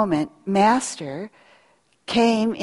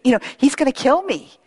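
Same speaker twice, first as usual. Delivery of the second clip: fearful protest; 6 semitones higher, faster than usual. Retold or imitated. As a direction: beg off, scared of being killed. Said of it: "Ananias is going".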